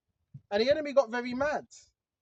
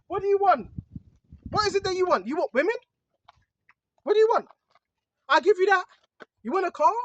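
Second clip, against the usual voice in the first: lightly acted insistent tempter; tempter's offers; 4 semitones higher, 5 dB louder, faster than usual. The enemy tempting the preacher with women and cars, insistent offers. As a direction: press each offer, a little louder and higher. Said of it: "I get tempted every day".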